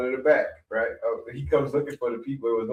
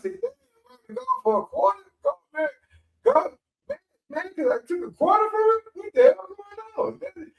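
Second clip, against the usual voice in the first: put-on much higher, incredulous childish voice; kid's outrage. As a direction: sound like a kid who can't believe it. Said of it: "I said".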